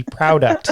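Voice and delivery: product said PROW-duct, from proud plus product; blended pronunciation. Shown as a demonstration. Host settling on guest's brand name, product as PROW-duct. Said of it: "It's gotta be product".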